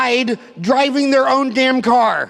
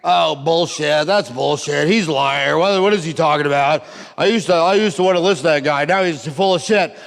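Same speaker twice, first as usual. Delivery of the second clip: scoffing homies; 5 semitones lower than usual. Retold or imitated. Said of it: "what do all my homies think?".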